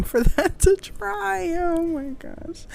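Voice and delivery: high, slow oh my gosh; exasperated sigh. Real, not acted. Crafter still waiting for fake milk to dry, slow high exasperation. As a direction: let it out up high, slowly, fed up.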